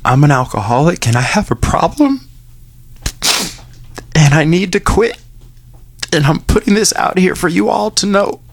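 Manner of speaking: high and strained, near tears; choked up. Real, not acted. Host rereading his public confession, voice breaking. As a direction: push through the words as the voice breaks.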